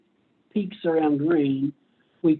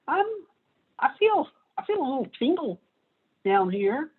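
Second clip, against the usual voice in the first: timid tingle; 7 semitones higher than usual. Acted. Green cone voiced timid.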